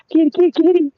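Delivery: high-pitched sing-song cat call; calling a kitty. Real, not acted. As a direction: coax the kitty over in a very high voice. Calling to cats on a walk.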